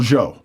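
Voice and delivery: "Joe" said as "Zho"; softened J. Retold or imitated. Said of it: "if it's the J thing".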